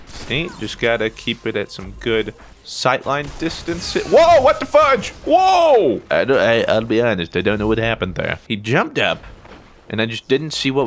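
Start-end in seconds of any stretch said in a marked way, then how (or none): 3.9-6.0 high, loud startled yells; startled shout. Real, not acted. Streamer gets jumped by an enemy, loud startled yelling. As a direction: shout out in real alarm.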